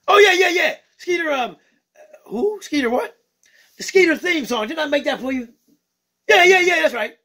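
excitable puppet bird greeting the fans; Skeeter the bird puppet. Acted. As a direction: play a cheeky funk-loving bird puppet.

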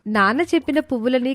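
usual speaking voice

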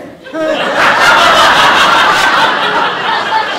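breathy cackle with a rising end, loud; wicked laugh. Acted. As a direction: laugh like she got away with it. Laugh after arsenic reveal.

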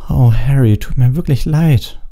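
put-on apologetic girl. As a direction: let her down gently, slowly. Cho apologizes to Harry.

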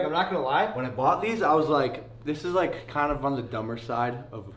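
talking with grill in mouth; wearing grillz on teeth. Real, not acted. Seen 1: Shiny grill over the teeth, clearly seen in close-ups at 7.6 and 8.1.

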